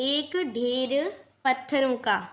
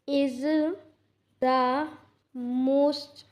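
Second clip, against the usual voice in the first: one word at a time, long pauses; word-by-word dictation; much slower than usual. Real, not acted. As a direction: dictate slowly as you write, each word set apart. Dictating while writing the sentence out. (लिखते हुए एक-एक शब्द रुक-रुककर बोलना)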